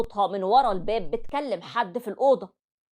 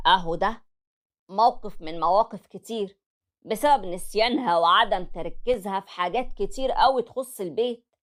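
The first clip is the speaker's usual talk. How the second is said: normal talking voice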